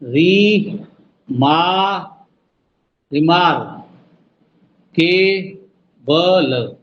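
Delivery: री मा- रिमार के बल as beats; syllable count. Shown as a demonstration. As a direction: count it out slowly. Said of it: "दोबारा देखो जरा।". (शब्दांश गिनते हुए)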